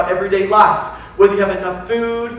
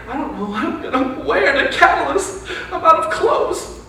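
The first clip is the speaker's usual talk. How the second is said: mock fretting; 4 semitones higher, 4 dB quieter than usual. Mock fretting about clothes.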